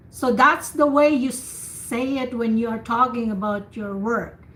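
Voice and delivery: usual speaking voice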